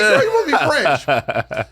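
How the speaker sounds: high, loud teasing drag-queen voice; sassy mock question. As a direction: tease her, high and loud. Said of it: "I was like".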